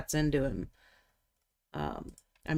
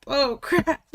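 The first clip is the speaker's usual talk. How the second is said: higher, louder alarmed cry; rising panic; 9 semitones higher, 5 dB louder than usual. Retold or imitated. Panicking over others' submissions.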